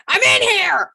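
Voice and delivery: high, loud shouted reply through a door; aggressive scream. Retold or imitated. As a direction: scream it to drive someone away.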